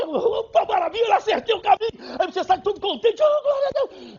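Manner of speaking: high, loud, delighted exclamation; joyful believer. Acted. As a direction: burst out with joy at finding the way. (crente eufórico, voz alta e aguda)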